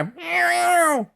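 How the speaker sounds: drawn-out high cartoon meow; familiar cat cry. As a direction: cry out like a cat mid-leap. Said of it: "they heard the familiar cry".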